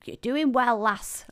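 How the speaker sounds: failed attempt at a Scottish accent. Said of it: "Obviously, I can't do a Scottish accent".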